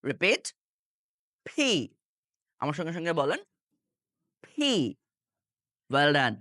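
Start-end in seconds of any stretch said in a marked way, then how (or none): 1.5-1.7 "ফি" with lips pressed shut, then released in a burst; popped P. Shown as a demonstration. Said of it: "ঠাস করে ছেড়ে দিয়ে বলেন". 4.4-4.7 "ফি" with lips pressed shut, then released in a burst; popped P. Shown as a demonstration. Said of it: "ঠাস করে ছেড়ে দিয়ে বলেন".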